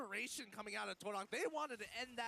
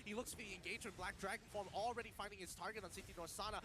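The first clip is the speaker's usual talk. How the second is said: ordinary talk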